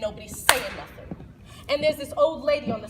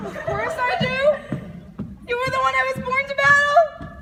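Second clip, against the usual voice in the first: high, loud declaration; heroic kid; 12 semitones higher, 7 dB louder than usual. Acted. Kid declares battle on the monster, heroic. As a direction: proclaim it high and strong.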